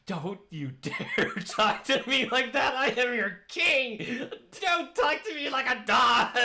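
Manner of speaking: shrill indignant screech, laughing; high, loud, laughing outrage; outraged high-pitched demon king. Acted. Demon king indignant at being babied.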